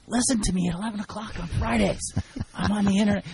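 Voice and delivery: high-pitched retold sales pitch; acted-out plug to a client; eager self-promotion. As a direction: pitch your show eagerly, voice lifted high. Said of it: "So I'll tell every client".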